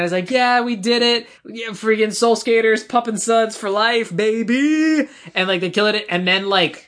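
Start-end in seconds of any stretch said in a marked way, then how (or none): 0.3-5.1 high, drawn-out victory whoop; freaking out. Retold or imitated. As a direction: imitate a champion celebrating, stretched words. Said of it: "he's, like, freaking out".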